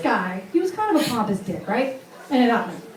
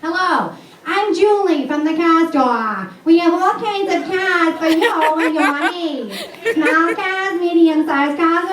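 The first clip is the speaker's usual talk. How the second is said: hammed-up local car commercial pitchwoman with New England accent; Lois; 3 semitones higher than usual. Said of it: "she sounds just like, uh, Lois".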